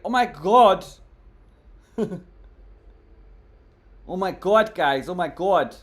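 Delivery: loud, high burst of oh my god between laughs; shocked outcry. Real, not acted. Streamer shouts to viewers at a chat joke: loud, high shock. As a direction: cry out in real disbelief at the chat.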